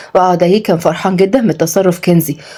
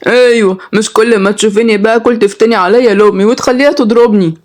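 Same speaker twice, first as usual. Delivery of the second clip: put-on voice of a complaining child; aggrieved boy; 2 semitones higher than usual. Boy accuses sister of getting him beaten, aggrieved. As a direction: grumble at your sister like a boy.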